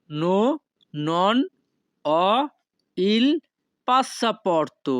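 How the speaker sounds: each word set apart by pauses; slow separated words for learners. Shown as a demonstration. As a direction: spell it out one word at a time. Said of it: "তখন আপনাকে বলতে হবে".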